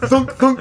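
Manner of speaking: loud, high mock thuds; thud sound effect. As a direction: bang out the door hits. Imitating door thuds.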